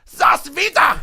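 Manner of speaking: high, loud mock announcer yell; shouted catchphrase. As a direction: belt it out like a hype call. Running joke shouted out.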